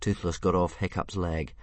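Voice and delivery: ordinary delivery, nothing marked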